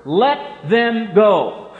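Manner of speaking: very slow, loud, ringing command; direct order. Retold or imitated. Preacher voicing Jesus's ultimatum to the cohort, loud slow command. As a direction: issue an ultimatum, each word weighed. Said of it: "a direct order".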